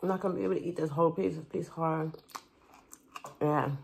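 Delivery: teeth gripping chewy skin; talking while biting food. Real, not acted. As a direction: mouth half blocked by food. Seen 2: Holds cow skin at mouth, teeth clamped on it 2.0–3.5s.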